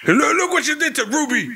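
high, whiny tattling voice; mock a sibling showing off someone's injury; mock scolding sibling. Friend mimicking a sister pointing at a broken wrist, high and whiny.

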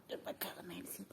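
soft unvoiced whisper; hushed whisper while fixing a pacifier. Real, not acted. Whispering near the baby.

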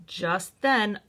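plain reading voice

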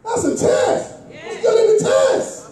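high, loud shouted preaching climax; preaching at full force. Acted. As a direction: lift the prayer into a shouting crescendo. Preaching climax, prayer quote.

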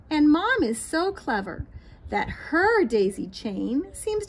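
lilting, sing-song storybook read; reading to children. A narrator's reading. Teacher reading a picture book to children, lilting. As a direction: read it aloud to little ones, bright and rhythmic.

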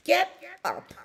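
high, sharp sheep command; two high punched words, as a sheep; baaing sheep. A narrator's reading. Sheep ordering the dog out, high baa. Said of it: "bawed sheep".

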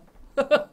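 short loud burst of laughter. Real, not acted. Loud laugh during game.

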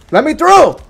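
high, loud demand to get past; frustrated yell. Real, not acted. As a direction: yell it at whoever is blocking you. Blocked in game, demand repeated louder.

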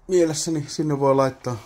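normal talking voice

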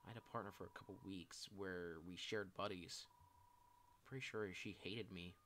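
subdued, self-blaming apology; dejected confession. Acted. Shy person apologizing for past awkward moments, dejected confession. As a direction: confess old regrets in a low-key, downcast voice.

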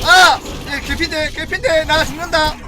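frantic loud panic; panicking gamer. Real, not acted. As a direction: scream like you're about to die. Near death in game.

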